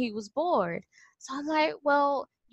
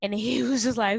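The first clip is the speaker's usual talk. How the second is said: ordinary delivery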